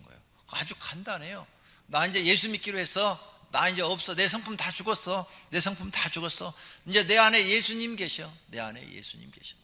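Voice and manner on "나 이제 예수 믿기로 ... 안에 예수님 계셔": high, loud declaration of faith; joyful confession. Shown as a demonstration. Pastor showing the congregation a bold confession, joyful. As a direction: proclaim it with joy. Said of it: "이렇게 말을 할 줄 알아야 돼요".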